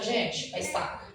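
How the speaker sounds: normal talking voice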